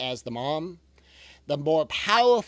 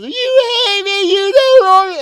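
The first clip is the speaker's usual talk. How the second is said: much higher and much louder, a slow wail; a daughter wailing at her calm mom; wailing child; 18 dB louder, slower than usual. Retold or imitated. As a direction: imitate a child howling in a tantrum. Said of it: "She's wailing like she's being tortured".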